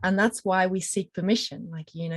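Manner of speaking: normal talking voice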